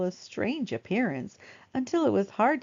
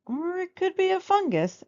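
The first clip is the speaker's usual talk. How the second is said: doctor's voice; 11 semitones higher, 3 dB louder than usual. Acted.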